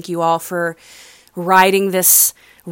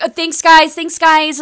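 a much higher, louder sing-song thank-you; chirpy instructor; 10 semitones higher, 5 dB louder than usual. Retold or imitated. Instructor running out, mimicked thanking the class brightly. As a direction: imitate the instructor breezing out the door. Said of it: "she's like".

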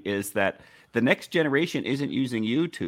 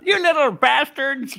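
put-on high, loud cranky old man yell; shouting at trespasser; 13 semitones higher, 5 dB louder than usual. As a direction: yell like a grumpy old geezer. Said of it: "Screw TikTok, shakes cane".

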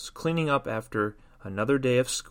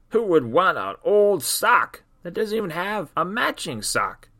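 higher, bitter grumbling sock; disgusted sock voice; 5 semitones higher, 4 dB louder than usual. Acted. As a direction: spit it out like a jaded old sock.

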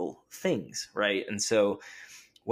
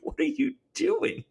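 baffled wife. Retold or imitated. Wife baffled in retold scene.

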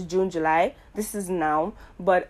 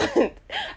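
bursts into a high-pitched laugh. Real, not acted. To listeners, laughing at her own oversharing, high laugh. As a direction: laughs at herself, pitch shooting up. Joking she needs therapy.

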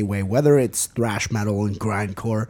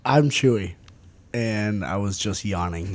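ordinary talk, nothing marked

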